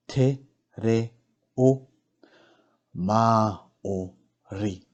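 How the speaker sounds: "Te reo Māori" very slowly, every syllable apart; slow teaching breakdown of "Te reo Māori"; broken into syllables. Shown as a demonstration. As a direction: sound out each syllable with clear gaps. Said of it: "break down the words first".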